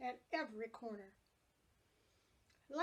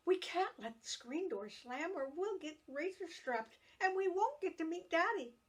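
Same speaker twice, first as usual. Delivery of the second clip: urgent warning, rising higher and louder; admonishing; 6 semitones higher, 4 dB louder than usual. A narrator's reading. Big sister warning little sister about the screen door, rising and louder. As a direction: scold her sister quickly before Mother hears. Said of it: "she admonished".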